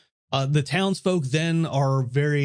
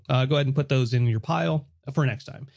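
ordinary delivery

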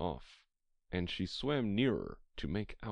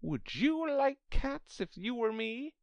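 high-pitched indignant mouse; shrill, passionate; 3 dB louder than usual. A narrator's reading. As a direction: demand it in a piercing, offended voice. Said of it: "in a shrill, passionate voice".

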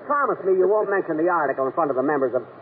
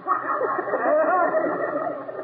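1 startled laugh; 14 semitones higher than usual. Real, not acted. Jack laughs at mover's question.

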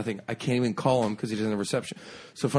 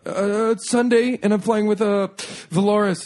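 flustered traveller stalling for the answer; nervous answer; 9 semitones higher, 5 dB louder than usual. Retold or imitated. Telling airport staff his flight, high and nervous.

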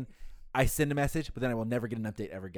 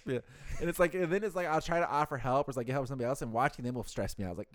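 ordinary delivery

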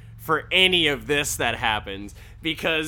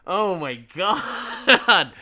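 laughing; 5 semitones higher, 7 dB louder, much slower than usual. Real, not acted. Speaker laughing at the scene.

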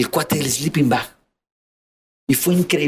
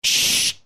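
shushing. Retold or imitated. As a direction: hush him like the guard did. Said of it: "cómo me hacía, "Shh". (siseo para callarlo)

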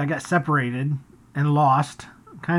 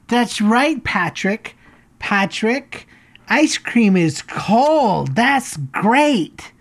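higher, over-enunciated mom talking for an audience; imitating loud mother; 5 semitones higher, 3 dB louder, much slower than usual. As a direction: parrot the child in a showy, drawn-out voice. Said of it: "very loudly".

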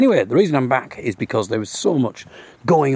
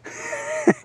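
high amused laugh; 4 dB quieter than usual. Real, not acted. Laughs at the cow joke.

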